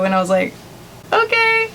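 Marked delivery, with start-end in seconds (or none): 1.1-1.7 tiny, very high-pitched reply; hammed-up timid squeak; meek mock okay. Meek reply to freaking-out mom.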